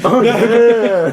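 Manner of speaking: hammed-up imitation; mocking echo. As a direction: parrot it back big and teasing. Mocking echo of the retold reply.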